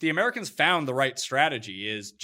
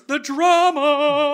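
drawn out, high and loud; stretched mock outrage; mock dramatic; 13 semitones higher, 11 dB louder, much slower than usual. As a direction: ham up the word drama.

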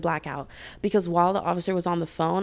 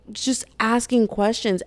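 usual speaking voice

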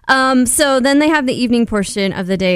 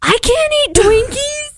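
much higher, squeaky mock whine; whiny guys mocked. Host mocks contestants refusing Twinkies, high squeaky whine. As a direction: mimic the guys' pitiful refusal. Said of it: "but these guys are like".

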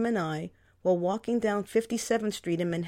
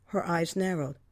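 plain reading voice

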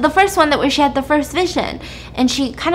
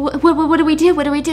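lightly acted tearful panic, higher; mock panicked stammer; 5 semitones higher, 3 dB louder, much faster than usual. Crying sister asks her brother for help, mildly panicked. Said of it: "she's crying".

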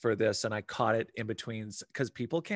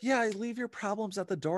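mock airy yoga teacher, pitched way up; 10 semitones higher than usual. Climbing yoga teacher soothing the class, mock airy voice. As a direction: parody a dreamy instructor. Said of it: "they're like".

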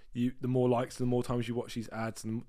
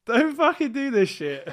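laughing; 13 semitones higher, 10 dB louder than usual. Real, not acted. Laughing plea after episode.

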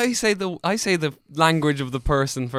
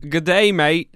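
loud, higher Australian greeting; 5 semitones higher, 9 dB louder, slower than usual. Pretend. Co-host greeting the listeners, Australian accent. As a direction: belt out an Australian hello. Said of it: "gonna read it phonetically here".

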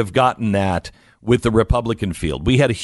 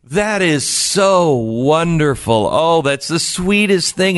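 lightly voiced gushing lady, slow on wonderful; imitating the woman who is suddenly charmed; 6 semitones higher, slower than usual. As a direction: coo over it, mildly hammed-up. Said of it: "she's like".

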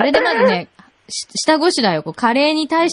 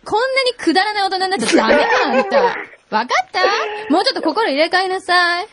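higher, fast preachy scolding; mock motherly lecture; 8 semitones higher than usual. Queen lectures caller, high fast scolding. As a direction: wag a finger and scold fast.

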